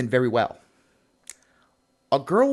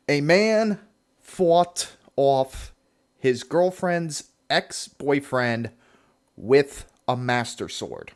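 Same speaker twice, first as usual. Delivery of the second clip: slow, with pauses between phrases; deliberate repeat; 3 semitones lower, much slower than usual. Real, not acted. Host repeats absurd headline to let it sink in, slow and deliberate. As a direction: spell it out phrase by phrase, slowly.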